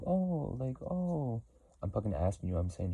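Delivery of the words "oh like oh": high, squeaky mocking oh; mock surprised oh. Mocking the people who watch her, a high sarcastic oh. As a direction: imitate them, dripping with sarcasm. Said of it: "It's just like".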